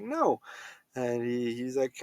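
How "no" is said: squeaky refusal. Retold or imitated. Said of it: "And he's like".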